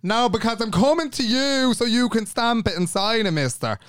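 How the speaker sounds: high-pitched, insistent comeback, dropping on the last word; exasperated retort. Retold or imitated. Customer explaining to the garda why she came, exasperated. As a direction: argue back, pitched well up.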